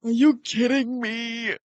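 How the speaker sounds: high, drawn-out cry of disbelief; exasperated shout. Real, not acted. Streamer sees the connection fail again, exasperated shout.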